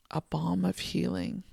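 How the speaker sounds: usual speaking voice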